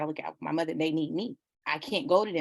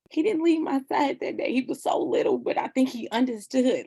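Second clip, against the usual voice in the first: much higher, emotion rising in the voice; emotional recollection; 7 semitones higher, 4 dB louder than usual. Real, not acted. Mother recalling her little son at the funeral, emotional. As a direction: voice lifted high with feeling.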